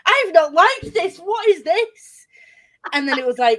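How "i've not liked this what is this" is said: high, loud, slow outraged gasp of a question; appalled mock disbelief, high and drawn out; shocked disbelief. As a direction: act out being scandalised by the post. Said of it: "I was like".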